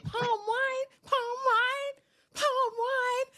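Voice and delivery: very high mock squeal; incredulous mimicry. Woman questions the wine he pushes on her, squealing incredulously. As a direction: squeak it out in exaggerated disbelief.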